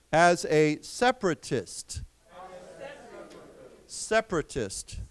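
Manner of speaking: measured teacher's dictation, phrase by phrase; read-aloud for repeating. Shown as a demonstration. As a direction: read clearly in chunks, leaving long pauses for the class. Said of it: "so let's read this".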